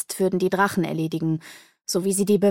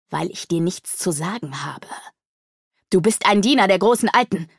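mustache twirling villain. Acted. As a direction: sneer it like a gloating villain. Demon scorns the mage.

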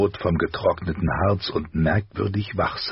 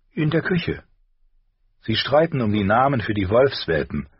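higher-pitched voice of the wife; mother's voice; 5 semitones higher than usual. A narrator's reading. Wife tells husband about the children, higher mother's voice. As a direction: lift the pitch for the mother's reply.

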